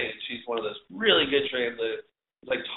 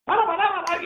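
much higher, laughing gibberish of a yelling translator; squeaky mock translator shouting nonsense syllables; imitating Russian yelling; 4 dB louder than usual. Translator yelling his order in Russian, laughing mock imitation. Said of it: "she's yelling in Russian".

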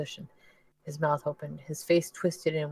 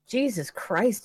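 shocked; 6 semitones higher, 4 dB louder, much slower than usual. Acted. Dennis shocked by the cold.

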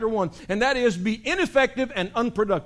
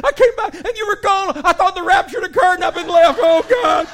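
mock crying; 11 semitones higher, 10 dB louder than usual. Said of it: "started crying".

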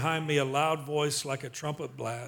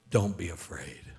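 much lower, settling voice; gentle reassurance; 10 semitones lower than usual. Acted. Risen Jesus to fallen John, low reassurance. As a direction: reassure solemnly, pitched way down. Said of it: "laid his right hand on me and said".

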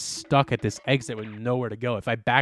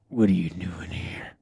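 hushed menacing whisper; whispered mock cop; 6 semitones lower than usual. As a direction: breathe it like a sinister cop. Cop voiced mockingly.

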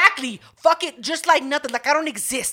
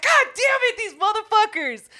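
much higher, mock-crying despair; anguished wail; 11 semitones higher, 3 dB louder than usual. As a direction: wail it like a hammed-up sob. Realizing cameras caught them.

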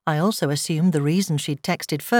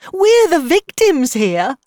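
high, loud, drawn-out protest; voice rising; 11 semitones higher, 7 dB louder, slower than usual. A narrator's reading. Mother protesting to her friend, voice rising. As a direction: wail it in indignation. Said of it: "her voice rising".

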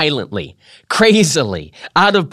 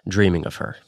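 hushed; 8 semitones lower, 8 dB quieter, faster than usual. A narrator's reading. Fading tearful close of reverie.

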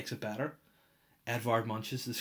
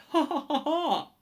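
high loud laugh; 9 dB louder than usual. Real, not acted. Laughing at own joke.

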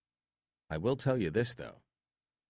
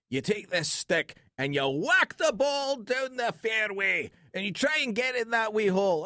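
hammed-up Scottish brogue explaining golf; Scottish accent; 12 semitones higher than usual. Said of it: "he does the whole thing in a Scottish accent".

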